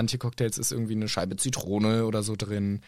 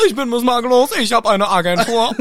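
hoch, laut, affektiert; nervige Imitation; 10 semitones higher, 8 dB louder than usual. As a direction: Miss Makellos nachäffen. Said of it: "Ich rede jetzt sehr nervig". (hoch und nervig nachgeäfft)

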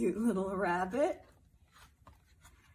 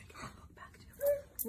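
whisper; 4 dB quieter than usual. Acted. Aside to child.

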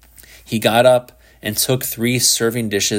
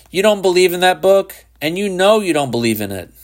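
taunting; 9 semitones higher, 4 dB louder, faster than usual. A narrator's reading. Shepard taunts the boy.

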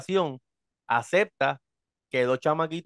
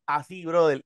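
usual speaking voice

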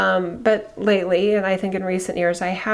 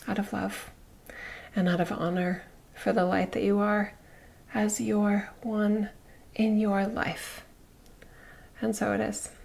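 soft closing blessing; 7 dB quieter than usual. Acted.

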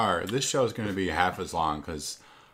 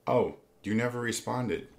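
usual speaking voice

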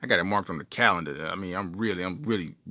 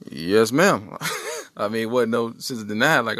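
ordinary talk

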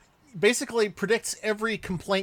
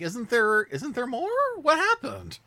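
higher-pitched, puzzled questioning; retold bewilderment; 7 semitones higher than usual. Viewer recalling being thrown by the show, mock bewildered. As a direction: climb higher with each baffled question. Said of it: "I was a little thrown by it".

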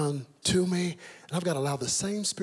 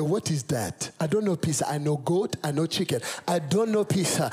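put-on puzzled protest in a Nigerian accent; Nigerian father. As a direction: imitate a Nigerian dad baffled by new food. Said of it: "He said".

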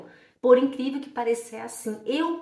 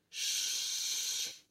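chiado longo e sem voz; som de sh; 11 dB quieter than usual. Shown as a demonstration. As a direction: sustente um shh demonstrativo. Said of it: "Esse é o som". (“xxxx” prolongado)

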